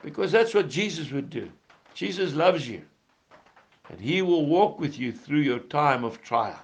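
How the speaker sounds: emotional. Real, not acted. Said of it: "I'm getting emotional here".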